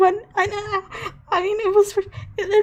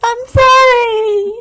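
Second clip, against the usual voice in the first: slow, wailing sob of sorry; crying apology; 6 semitones higher, 4 dB louder, much slower than usual. Acted. Seren wails an apology to Alara, sobbing. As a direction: wail it out loud and slow.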